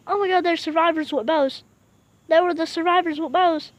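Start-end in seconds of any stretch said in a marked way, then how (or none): none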